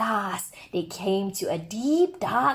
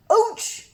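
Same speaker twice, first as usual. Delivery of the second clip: high yelp of pain; 11 semitones higher, 4 dB louder than usual. Acted. Hugs a splintery tree.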